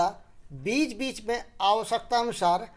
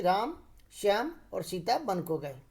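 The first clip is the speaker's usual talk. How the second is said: "राम", short stop, softer "Shyam और Sita"; pause after राम for clarity; 2 semitones lower, 4 dB quieter than usual. Shown as a demonstration. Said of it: "तो बोलने में स्पष्टता भी आती है".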